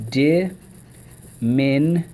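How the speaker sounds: DIMINUTIVE very slow, a gap after each syllable. Shown as a demonstration. Teacher repeating the drill for the class, syllable by syllable. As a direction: sound out DIMINUTIVE in four pieces. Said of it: "So let's go".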